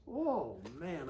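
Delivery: plain reading voice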